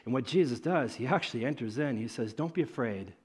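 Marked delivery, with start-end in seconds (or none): 2.3-3.1 soft, low comfort; calm a frightened friend; quiet, tender reassurance; gentle reassurance. Acted. Jesus actually speaks in storm.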